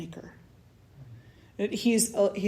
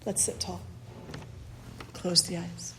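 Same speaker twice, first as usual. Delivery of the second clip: guided meditation; 7 dB quieter than usual. Acted. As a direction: ease them into the breath, gently.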